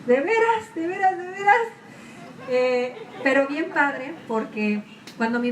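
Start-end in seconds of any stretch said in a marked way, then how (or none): 0.1-1.7 pitched way up in mock disbelief; a higher, louder cry of really, really; exasperated disbelief. Crying out to God in exasperated disbelief. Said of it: "decía yo".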